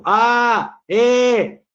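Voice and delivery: sudden loud, high open-vowel shout; shouting demo. Shown as a demonstration. Lecturer shouts vowels to show their volume, loud shout. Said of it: "si grito".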